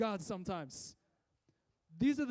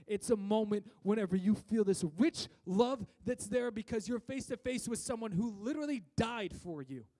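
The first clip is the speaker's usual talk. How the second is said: usual speaking voice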